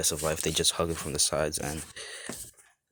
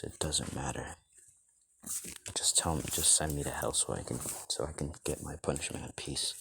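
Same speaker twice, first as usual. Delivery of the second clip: low, soft, resigned character voice; subdued plea to be left to punishment; 4 semitones lower, 8 dB quieter than usual. Acted. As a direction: drop down quiet, as if giving up.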